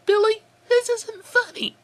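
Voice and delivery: put-on high girl's voice calling out. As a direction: call out high to an unseen prankster. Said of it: "then called out".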